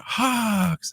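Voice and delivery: high, drawn-out cheer at held-back volume; imitated crowd answer. Other side of the stadium answering the beer man, imitated yell. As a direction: mimic fans calling back across a stadium, long and high. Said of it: "And the other side was".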